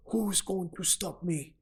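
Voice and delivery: bad Russian accent. Boxer taunting all comers, in a bad Russian accent. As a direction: draw it out in a clumsy Russian accent. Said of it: "that was a really bad Russian accent".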